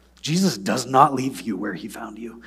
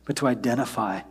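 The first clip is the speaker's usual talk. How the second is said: soft, quiet prayer voice; hushed pastoral prayer; closing prayer; 2 semitones lower, 7 dB quieter than usual. Real, not acted. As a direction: pray gently over the people. Leads congregation in prayer.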